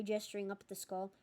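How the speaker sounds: plain reading voice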